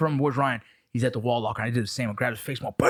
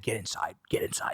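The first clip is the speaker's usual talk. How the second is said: hoarse whisper of a boxing coach; 7 semitones lower, 7 dB quieter than usual. Retold or imitated. Father coaching sons to fight, urgent whisper. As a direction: hiss it low and urgent. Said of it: "And he's like".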